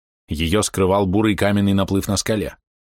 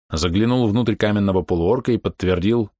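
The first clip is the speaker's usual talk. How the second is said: ordinary delivery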